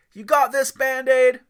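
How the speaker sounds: high, loud cheering dad; encouraging shout. Acted. Dad shouting up to his son to jump. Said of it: "Aiden's dad shouted".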